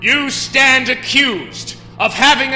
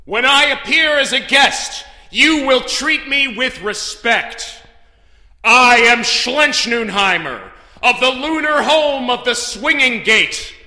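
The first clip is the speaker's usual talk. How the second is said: imperious proclamation; 9 dB louder than usual. Acted. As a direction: declaim it with ringing force. Judge demands respect, proclaims name.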